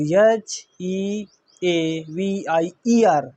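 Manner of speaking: slow, separated letters for the class; deliberate spelling. Shown as a demonstration.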